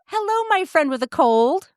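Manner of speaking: normal talking voice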